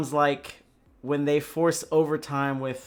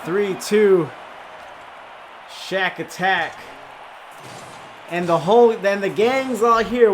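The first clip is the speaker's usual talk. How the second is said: shouting, excited play-by-play call; live play-by-play; 5 semitones higher, 7 dB louder than usual. Acted. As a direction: call the goal at full hype. Live Rocket League casting.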